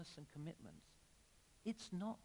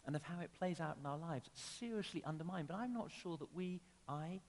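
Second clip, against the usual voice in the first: ordinary talk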